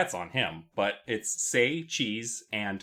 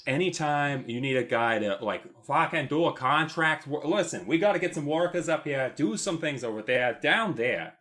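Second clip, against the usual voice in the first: loud, higher Boston-ish city guy; brash contractor in a mashed city accent; 4 semitones higher, 3 dB louder than usual. Pretend. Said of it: "my Boston accent is really just a conglomeration".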